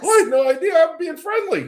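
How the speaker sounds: high-pitched self-defense; exasperated, high defensive reply; protesting. Real, not acted. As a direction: protest your innocence, voice shooting up. Defending himself when challenged.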